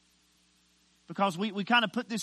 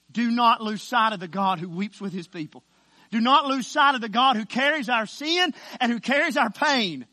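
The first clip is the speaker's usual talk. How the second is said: loud preaching that climbs higher; forceful exhortation; 3 semitones higher, 9 dB louder than usual. Acted. Preacher urging the grieving to keep sight of God, forceful. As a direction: drive the appeal upward with force.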